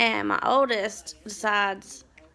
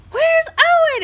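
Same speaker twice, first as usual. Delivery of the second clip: very high, loud, frantic question; alarm, a child is missing; mock-frantic cry of a worried mom; panicked question; 13 dB louder, slower than usual.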